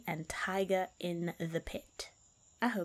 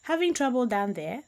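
teasing; 5 semitones higher, 5 dB louder than usual. Acted. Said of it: "looked down and smiled".

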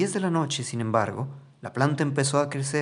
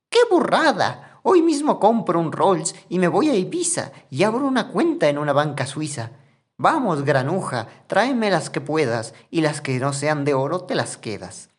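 wild excitement; 4 semitones higher, 5 dB louder than usual. Acted. Mother thrilled at the gold, ordering Juan up, shrieking excitedly. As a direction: burst out, dazzled by sudden riches. Mother sees the golden beanstalk.

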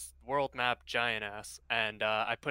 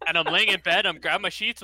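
mock panic; 5 semitones higher, 9 dB louder than usual. Retelling midnight scare in bed.

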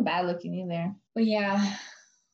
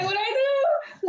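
high, drawn-out mock wail; retold scream; slower than usual. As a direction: imitate your own panicked shriek, stretched out.

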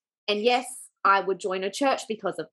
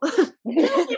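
laughing through the words, higher; laughing plea; 6 semitones higher, 3 dB louder than usual. Real, not acted. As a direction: beg for more between giggles. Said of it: "And tell me more".

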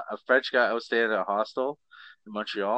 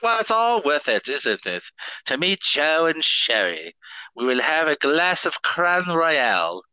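put-on English voice, drawn out; English accent; 2 semitones higher, 5 dB louder, slower than usual. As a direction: play at being English, louder and slower. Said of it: "This is my English voice".

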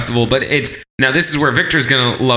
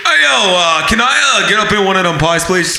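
pizzeria caller; 3 semitones higher than usual. Acted. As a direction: put on a mock pizza-order call. Acts out customer phoning pizzeria.

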